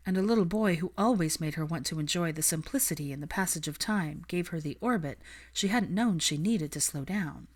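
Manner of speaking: ordinary delivery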